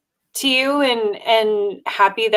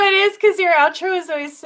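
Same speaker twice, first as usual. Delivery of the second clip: usual speaking voice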